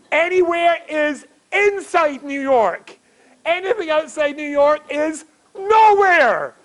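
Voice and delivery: high, slow, drawn-out proclamation; emphatic proclamation. Acted. Comedian spells out the New Yorker's logic, emphatically.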